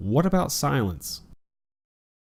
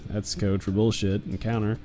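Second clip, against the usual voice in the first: usual speaking voice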